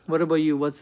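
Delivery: ordinary talk, nothing marked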